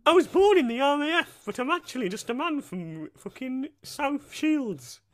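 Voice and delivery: put-on high-pitched comic Geordie accent; high Newcastle voice, slowing as it goes. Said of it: "try do a Newcastle accent".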